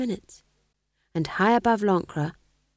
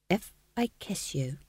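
softly, carefully feeling out the words; 8 dB quieter than usual. A narrator's reading. Fool asks young witch about a kiss, carefully. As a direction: venture it softly, a little hesitant. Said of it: "he added carefully".

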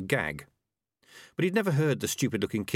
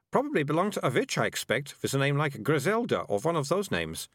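put-on higher, know-it-all young girl lecturing; a bossy girl explaining things; prim girl; 7 semitones higher than usual. Melissa guessing about witches.

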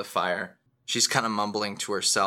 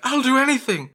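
high, loud pleading cry; desperate mother; 7 dB louder, faster than usual. Acted. Mother begging a patron to spare her daughter, desperate. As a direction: beg frantically in a high voice. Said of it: "She's like".

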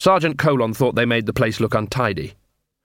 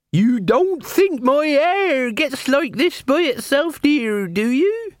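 high, drawn-out beggar's whine; whining wheedle; much slower than usual. Acted. Beggar in rags greets the watch, high wheedling whine. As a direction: wheedle slowly, pitched way up. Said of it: "no trace of whine or wheedle".

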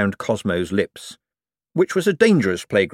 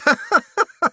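giddy laugh. Acted. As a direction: giggle growing into a laugh. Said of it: "grew very quickly into a laugh".

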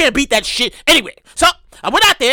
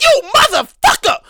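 mock outrage; 6 semitones higher than usual. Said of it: "theme of the whole night".

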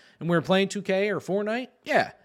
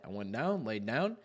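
ordinary talk

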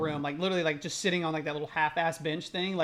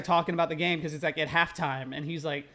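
ordinary delivery

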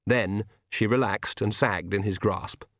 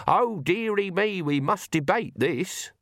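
high, slow fussy mockery; mocking hand-wringer; 8 semitones higher, slower than usual. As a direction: simper like a fretful worrier. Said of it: "wring your hands and say".

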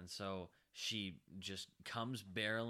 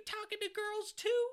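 very high, loud mock interrogation; high-pitched suspicious mother parody; imitating accusing mom; 5 dB louder than usual. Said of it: "she's like".

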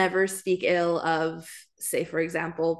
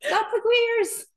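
much higher, slow put-on old queen voice; failed queen voice; 4 dB louder, slower than usual. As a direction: attempt a British old lady voice, badly. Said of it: "I can't do the voice".

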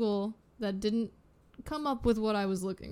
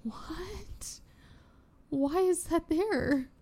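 baffled whisper; 8 semitones higher, much slower than usual. Retold or imitated. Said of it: "you're like".

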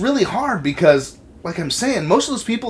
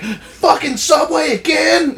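much higher, louder, slow mock shout; imitating mom yelling; 13 semitones higher, 7 dB louder, slower than usual. Son imitates his mom yelling about Subway, a mock angry shout. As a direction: ham up a furious mom scolding. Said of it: "She'll call me fucking screaming and yelling".

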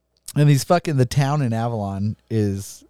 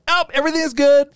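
higher and fast, imitating himself; mock breezy; 14 semitones higher, faster than usual. Crashed drunk rider to helpful bystanders, high and fast. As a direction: rattle off a breezy all-is-fine. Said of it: "I'm like".

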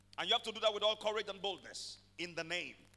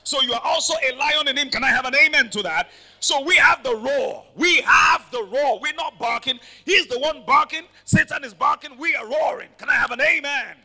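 loud, rising sermon cry, higher in pitch; full-force preaching; 5 semitones higher, 11 dB louder than usual. Acted. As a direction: drive the congregation up, louder and louder.

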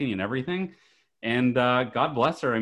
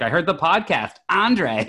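higher, louder mock-excited hello; playfully imitating an eager fan; excited greeting; 8 semitones higher, 5 dB louder than usual. Dad imitating Leslie's mom calling out the name. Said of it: "She's like".

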